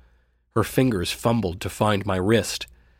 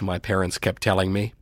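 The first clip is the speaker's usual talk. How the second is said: plain reading voice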